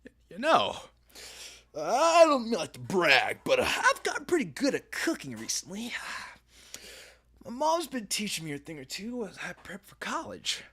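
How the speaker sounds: bragging youth. Acted. College-bound kid bragging about cooking, hammed-up. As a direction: puff it up like a proud amateur cook. Voices main character bragging.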